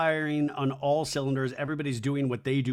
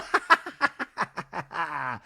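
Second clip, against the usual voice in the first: mock cackle. Acting Lindsay's laugh after retort.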